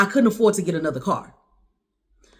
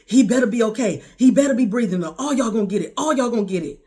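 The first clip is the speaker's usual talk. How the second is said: higher, louder angry warning; frantic threat; 4 semitones higher, 4 dB louder than usual. Retold or imitated. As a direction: warn them fiercely, re-enacting the outburst. Retold threat outside hospital.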